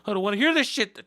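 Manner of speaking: hearing norteño music, mock panic. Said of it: "And I'm just like".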